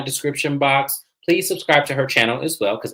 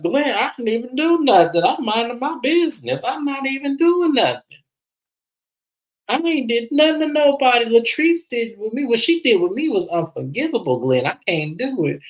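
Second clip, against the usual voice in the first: high-pitched, whiny mock protest; imitating Marie; 11 semitones higher than usual. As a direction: plead innocence in a put-on high voice. Acts out Marie's protesting to Glenn.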